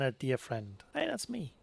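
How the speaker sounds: usual speaking voice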